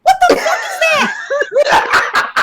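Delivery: high-pitched, laughing outburst; hammed-up disbelief at a sudden sight; acted shock. Player counters the calm line with alarm, acted shock. Acts out shocked reaction to dragon.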